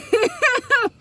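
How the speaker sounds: a short high burst of laughter; amused high laugh. Real, not acted. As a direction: laugh at your own opinion, high and loud. Laughs after praising a song.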